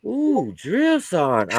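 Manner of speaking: high, slow, admiring coo; drawn-out mock swoon; swooning Private Alpha. Private coos at the flexing drill sergeant, mock swoon. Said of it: "Private Alpha goes".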